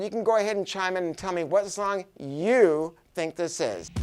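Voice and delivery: ordinary talk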